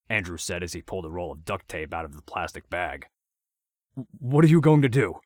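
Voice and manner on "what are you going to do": higher, louder, frightened plea; urgent. A narrator's reading. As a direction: blurt it out in a panic. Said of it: "with urgency in his voice".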